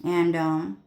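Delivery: ordinary delivery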